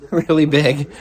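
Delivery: really big said through a laugh. Real, not acted. Repeats phrase with laugh.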